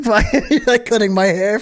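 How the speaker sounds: high, loud laughing; high-pitched giddy laughter. Real, not acted. Laughing at the barber's mistake.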